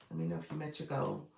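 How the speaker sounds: ordinary delivery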